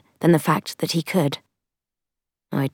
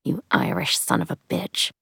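softly muttered insult; under-breath mutter; 6 dB quieter than usual. A narrator's reading. Beaten Culver insults Tom under his breath, muttered. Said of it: "Under his breath, he muttered".

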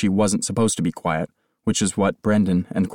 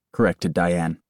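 ordinary delivery

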